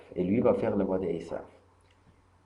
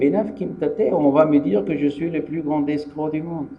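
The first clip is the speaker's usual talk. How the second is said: worried Yaakov; 7 semitones higher, 5 dB louder than usual. Acted. Yaakov fearful of being caught.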